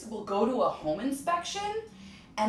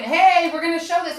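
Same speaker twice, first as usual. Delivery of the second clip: barging agent; 9 semitones higher, 8 dB louder than usual. Retold or imitated.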